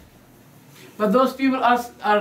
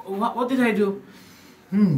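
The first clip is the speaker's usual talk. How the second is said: ordinary delivery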